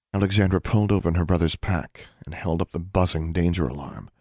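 plain reading voice

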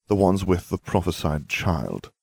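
ordinary delivery

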